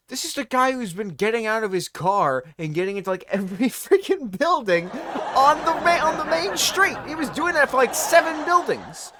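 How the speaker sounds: high, loud, incredulous outburst; exasperated rant. Real, not acted. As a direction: voice climbing high as you vent in disbelief. Exasperated rant about the driver.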